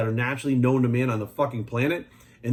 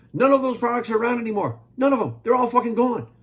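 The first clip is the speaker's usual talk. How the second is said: heated rant; 9 semitones higher, 4 dB louder than usual. Real, not acted. Ranting about vanished products.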